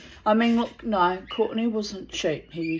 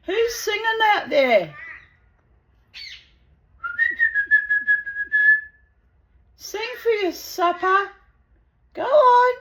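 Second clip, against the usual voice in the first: high, loud sing-out to the birds; loud, coaxing high call; 8 dB louder than usual. Real, not acted. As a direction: call out in a pitched-up pet voice. Calls out to singing birds.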